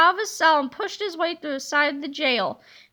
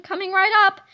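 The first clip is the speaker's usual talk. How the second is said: louder, eager-to-please warden; eager servant; 4 semitones higher, 6 dB louder, faster than usual. Acted. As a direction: chirp it like a happy waiter. Warden serving prisoner.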